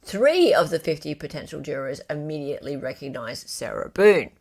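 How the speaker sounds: ordinary talk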